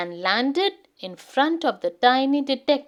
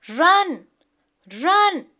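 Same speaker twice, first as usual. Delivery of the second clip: louder, higher urgent call to run; 5 semitones higher, 5 dB louder than usual. Acted. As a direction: hurry everyone along, calling out. Mum urging escape from snow.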